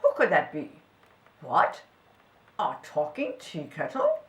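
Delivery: low, baffled questioning; a hint of a junk man puzzling it out; lightly voiced puzzled junk man.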